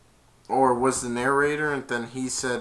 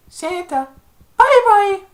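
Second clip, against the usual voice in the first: put-on high baby voice; 10 dB louder than usual. Baby Grinch voiced in retelling.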